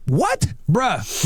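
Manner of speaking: ordinary talk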